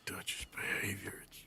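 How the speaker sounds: hushed, voiceless whisper about Dutch. Acted. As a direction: drop to a secret whisper. Arthur confides worry about Dutch.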